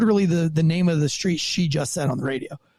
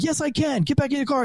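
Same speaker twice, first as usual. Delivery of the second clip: higher, firm mock order; firm command; 7 semitones higher than usual. Cop retelling arguing with the driver, mild mock yell. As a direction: shut him down firmly. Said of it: "I'm like".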